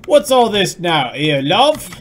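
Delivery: loud, slow, hammed-up British accent. Streamer trying again to sound British for chat, hammy British accent. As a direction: put on a big mock-British voice. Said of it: "Oh, that's getting better".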